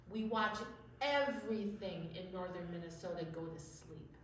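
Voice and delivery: usual speaking voice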